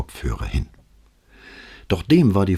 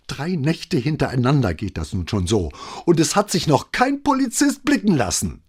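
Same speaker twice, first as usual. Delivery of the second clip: climbing higher as she goes; growing shriller; 10 semitones higher than usual. A narrator's reading. Neighbour losing patience with the police, getting shriller. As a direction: let the voice rise into shrillness. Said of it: "Ihre Stimme wurde merklich schriller".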